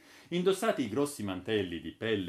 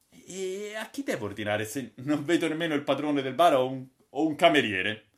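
put-on louder, puzzled old gentleman asking; lightly voiced Braddock; 6 semitones higher, 8 dB louder than usual. Braddock asking Holker who takes orders, louder puzzlement. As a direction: ask like a bewildered visitor.

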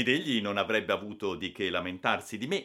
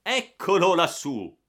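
high, slow, loud call; drawn-out shout of discovery; exclaimed discovery; 10 semitones higher, 11 dB louder than usual. A narrator's reading. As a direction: call out on spotting the culprit. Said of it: "ed esclamò".

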